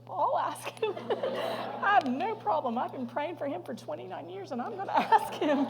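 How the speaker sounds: loud, high, laughing retold answer. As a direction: burst out laughing while quoting yourself. Laughs retelling her eager answer.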